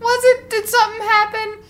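tiny, very high drawn-out squeak; high, slow baby questioning; baby's voice. Retold or imitated. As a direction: voice a puzzled baby in falsetto. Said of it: "me and the baby were like".

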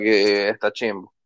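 ordinary talk

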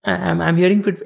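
usual speaking voice

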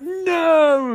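high anguished cry; hammed-up grief; mock anguished no. Husband as wife dies.

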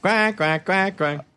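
another duck audition. Shown as a demonstration. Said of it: "are you the duck".

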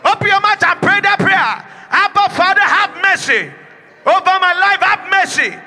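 high, loud shouted prayer leading; preaching at full force. Real, not acted. Pastor leading the church in loud prayer for mercy. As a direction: push the church to cry out.

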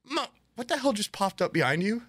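high, startled mock question; startled stewardess. Stewardess runs over to a noise two rows back, startled.

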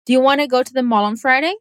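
"do you" squeezed very fast, "wanna", "go", "mall" and "Friday" stressed; reduced do you. Shown as a demonstration. Teacher showing the natural rhythm with a quick do you. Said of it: "A gente vai deixar o do you super rapidinho".

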